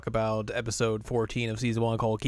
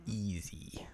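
breathy whisper; whispered easy; 2 semitones lower, 13 dB quieter than usual. Real, not acted. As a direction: whisper it smugly. Aside after bragging.

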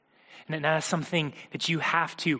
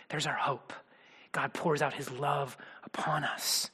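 whisper; 4 semitones lower, 7 dB quieter than usual. Real, not acted. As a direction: let it fall to a hush. Hushed climax of sermon point.

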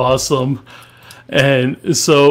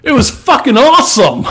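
excited; 9 semitones higher, 6 dB louder than usual. Acted. Mock-enthusiastic redo of verdict.

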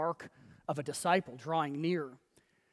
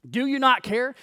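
raised, loud accusing challenge; accusatory question; 6 semitones higher, 8 dB louder than usual. Acted. Martha blaming Jesus, loudly accusatory. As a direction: fling it out as blame. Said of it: "It's an accusatory question".

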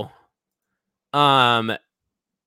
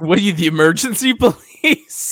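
laughing echo; 7 semitones higher, 7 dB louder than usual. Real, not acted. Co-host echoes laughing.

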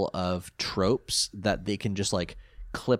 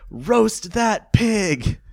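high, loud, drawn-out crowd shout; heckler's yell; 11 semitones higher, 6 dB louder, much slower than usual. Retold or imitated. Podcaster retells a crowd member heckling Joey, mock yell. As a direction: imitate a spectator yelling from the stands. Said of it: "someone from the crowd yells".

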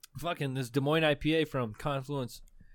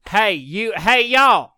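loud, high Texas holler; Phantom Bell man; 5 semitones higher, 10 dB louder, much slower than usual. Pretend. Ghost bellman hailing folks, in a shouted Texas accent. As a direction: call out in a hammed-up southern accent. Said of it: "Talks like a guy from Middle Texas".

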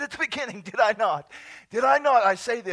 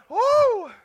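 loud, high "woo"; high celebratory holler; exuberant whoop; 11 semitones higher, 12 dB louder than usual. Real, not acted. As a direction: let out a big whoop after laughing. Whoops after the laughing fit.

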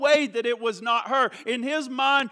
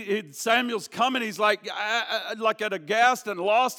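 ordinary talk

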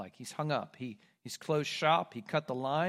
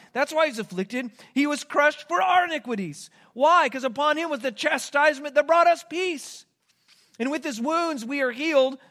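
loud, higher, driving sermon climax; impassioned pulpit proclamation; preaching at full force; 8 semitones higher, 5 dB louder than usual. Acted. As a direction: preach it out to the whole room.

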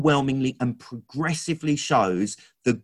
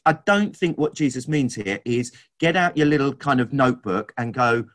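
usual speaking voice

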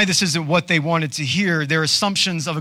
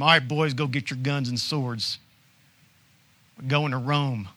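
put-on light Southern accent on an offhand order; a hint of Southern in a mock rallying order; 2 semitones lower, 4 dB quieter than usual. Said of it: "default to Southern accent right there".

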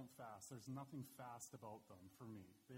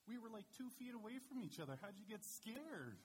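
exasperated dad; 9 semitones higher, 3 dB louder than usual. Retold or imitated. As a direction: drag it out in playful disbelief.

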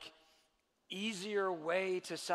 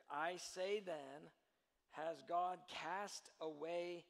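slow scripture reading; 5 dB quieter, slower than usual. Shown as a demonstration. Preacher reading Romans to congregation, slow and deliberate. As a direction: read slowly, each word weighed. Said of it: "Paul says it".

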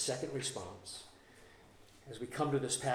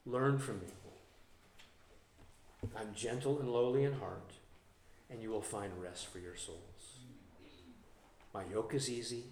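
soft tender reading; 5 semitones lower, 6 dB quieter than usual. Acted. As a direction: read it softly, like comfort. Reading Jesus' words softly.

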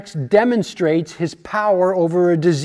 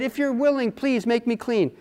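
higher, softer plea; begging; 5 semitones higher, 5 dB quieter than usual. Retold or imitated. Man with leprosy begs Jesus to heal him, begging. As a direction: plead gently, pitched up. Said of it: "begged him, said".

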